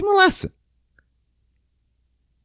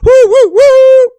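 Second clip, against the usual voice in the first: very high, loud whooping; high, loud thrill-ride whoops; mock coaster whoops; 12 dB louder than usual. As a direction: whoop like riding a roller coaster. Imitated roller coaster cheer.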